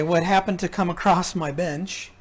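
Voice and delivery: ordinary talk, nothing marked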